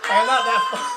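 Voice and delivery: words dissolve into laughter; real laugh; laughing hard. Real, not acted. Laughing at Freddy Krueger joke.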